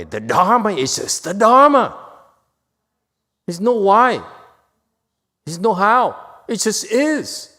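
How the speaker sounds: loud, high, forceful declaration; emphatic loud proclaiming. Real, not acted. Teacher proclaiming the Dharma to the class, loud and forceful. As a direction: hammer home each word like a preacher.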